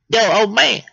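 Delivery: high, loud cheeky call; squirrel character. Acted. Squirrel hailing the old man, high loud voice.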